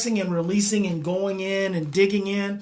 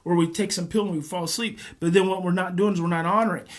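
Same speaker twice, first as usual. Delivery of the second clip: normal talking voice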